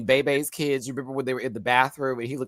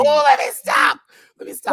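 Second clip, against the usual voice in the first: laughing loud plea; 13 semitones higher, 8 dB louder than usual. Real, not acted. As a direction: cry it out through laughter.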